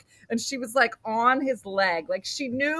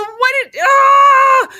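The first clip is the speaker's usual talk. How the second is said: high, hammed-up panicked shriek; retold panic; 13 dB louder, much slower than usual. Mom watching elevator take her daughter, panicked scream. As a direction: question breaking into a loud scream.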